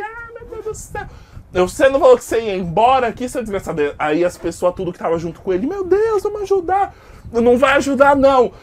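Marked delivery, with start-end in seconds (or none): 0.0-1.1 much higher, softer put-on groan; hammed-up dazed victim. Voicing the fallen customer. 1.7-3.9 loud fast jeer; angry mocking taunt. Narrator scolds customer in fight. 5.7-6.9 much higher, louder alarmed cry; panicked companions. Acted. 7.3-8.5 loud, higher stern refusal; loud forbidding. Acted. Narrator forbids helping.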